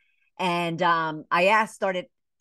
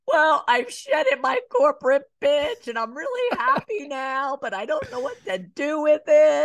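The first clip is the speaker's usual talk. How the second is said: high, louder voice close to tears, reliving the moment; tearful breakdown; 9 semitones higher, 4 dB louder than usual. Retold or imitated. Retelling to a host the breakdown at the lunch table, tearful and high. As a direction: let the words crack as you fight back tears. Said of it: "tear-filled, you know, breakdown".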